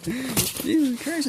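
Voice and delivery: high laughter, slow drawn-out exclamation; laughing. Real, not acted. Reacting to the bricks order, laughing. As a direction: laugh it out in disbelief.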